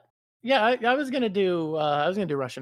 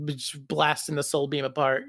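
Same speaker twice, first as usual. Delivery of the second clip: ordinary talk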